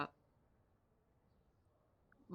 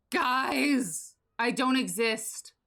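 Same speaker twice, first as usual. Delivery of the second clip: higher, louder, slow dramatic wail; mock shout; 7 semitones higher, 8 dB louder, much slower than usual. Real, not acted. Streamer to her chat about no reflection, mock shout. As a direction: cry out to chat in mock panic. Game character has no reflection.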